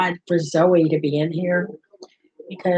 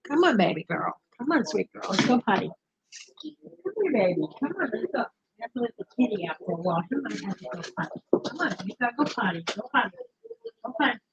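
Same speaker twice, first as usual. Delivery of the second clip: hushed, higher coaxing of a tiny dog; soft pet talk; 4 semitones higher, 9 dB quieter, faster than usual. Real, not acted. Owner quietly coaxing her chihuahua outside, soft high baby talk. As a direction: softly sweet-talk the dog out the door.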